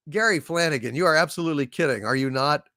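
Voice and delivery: ordinary talk, nothing marked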